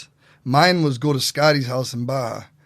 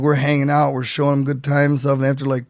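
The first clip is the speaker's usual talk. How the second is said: usual speaking voice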